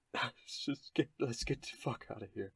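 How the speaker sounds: high, soft, shaken plea; a rattled man begging to go. Acted. Halfling pulling away from Carver, high shaken plea.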